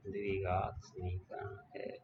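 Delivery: slow, deliberate dictation of the answer; slow dictating pace for note-taking. Shown as a demonstration. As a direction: spell it out slowly so students can write. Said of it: "teacher repeats answer for writing down".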